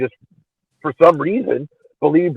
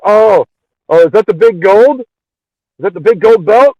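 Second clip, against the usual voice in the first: surprised; 5 semitones higher, 5 dB louder than usual. Real, not acted. Surprised by the gold belt.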